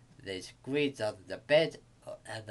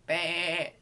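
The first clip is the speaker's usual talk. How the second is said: louder drawn-out bleat; sheep's bleat; 5 semitones higher, 5 dB louder than usual. A narrator's reading. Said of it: "said the sheep".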